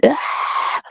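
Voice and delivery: short high squalling cry; mock baby scream. Baby keeping mother awake, high squall. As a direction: imitate a screaming newborn. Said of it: "they're still screaming".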